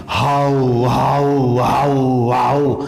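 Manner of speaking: repeated, sustained "हाउ" run together; imitated howling. Shown as a demonstration. Teacher making the sound of a howl for students, imitated howling. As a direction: chant "हाउ" like a howl, sliding down on the last. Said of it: "की आवाज निकालना".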